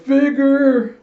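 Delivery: high, loud, drawn-out cry; calling out. Retold or imitated. York calling out to Figure, desperate shout. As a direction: holler the name in alarm. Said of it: "York calls out".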